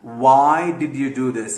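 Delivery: very slow, deliberate example sentence; slow English example. Shown as a demonstration. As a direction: spell out each word of the question. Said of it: "question لفظ جیسے ہوتا ہے".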